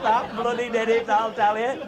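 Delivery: usual speaking voice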